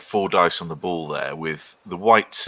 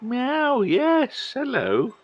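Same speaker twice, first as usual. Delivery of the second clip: much higher meow and cooing hello; meowing at cat; much slower than usual. Real, not acted. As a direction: greet the cat in its own high meow. Greeting the cat.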